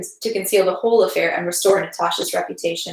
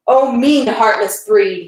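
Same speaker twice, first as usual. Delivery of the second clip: explosive anger; 3 semitones higher, 4 dB louder, much slower than usual. A narrator's reading. Pierre exploding at Anatoly's timorous smile, furious. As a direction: spit the words out in disgust. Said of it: "made Pierre explode".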